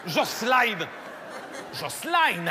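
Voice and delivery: mock Portuguese-accented calling; Christina Cordula. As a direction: call the name with an exaggerated Portuguese accent. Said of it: "un, un accent portugais". (appel avec accent portugais)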